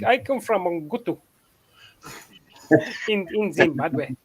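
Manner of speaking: lightly put-on African accent; imitated African accent, slowing at the end. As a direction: recite it in a mock African accent. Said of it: "That's what I, I used to say".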